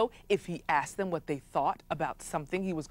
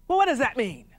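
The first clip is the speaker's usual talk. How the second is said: irritable chief ranting; 6 semitones higher, 3 dB louder, faster than usual. Acted. Chief demands meaning.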